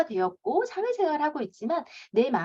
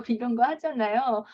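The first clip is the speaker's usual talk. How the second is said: ordinary delivery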